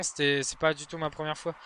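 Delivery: ordinary delivery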